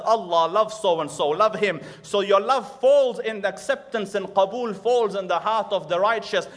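loud, high, building sermon; full-force preaching; fervent preaching. Acted. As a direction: lift the crowd with rising fervor.

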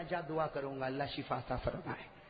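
quiet and low, tender; hushed tender prayer; very soft gentle voice. Acted. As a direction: speak it softly like a blessing. Prophet's gentle soft reply.

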